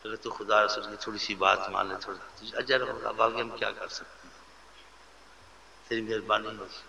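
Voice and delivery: soft, low, pleading brotherly advice; gentle request. Acted. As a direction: imitate a brother asking kindly and humbly. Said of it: "آرام سے".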